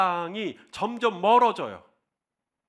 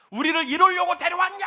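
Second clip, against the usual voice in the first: high, loud resentful accusation; complaining crowd; 10 semitones higher, 5 dB louder than usual. Retold or imitated. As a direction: throw the blame at Moses. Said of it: "하면서 막 원망해요".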